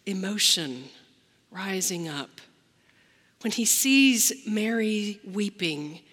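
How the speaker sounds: normal talking voice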